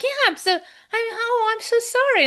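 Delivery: very high, gushing apology; played-up, stumbling condolence; retold empathy. Re-enacts her sympathetic reply on subway.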